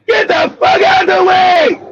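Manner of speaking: impatient driver shouting out the window; retold yell. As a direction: bark it like an angry driver. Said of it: "people yelling at me".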